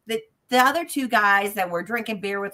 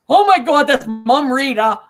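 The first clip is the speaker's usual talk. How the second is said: loud, high shout of recognition; retold astonished outcry; 4 semitones higher, 8 dB louder than usual. Biker recognizing his mother's name, astonished outcry. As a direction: cry out as the stunned biker.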